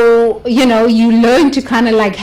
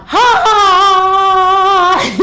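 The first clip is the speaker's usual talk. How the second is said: big open ha ha ha, higher and louder; loud belly laugh; 10 semitones higher, 4 dB louder, much slower than usual. Shown as a demonstration. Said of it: "I used to laugh out loud".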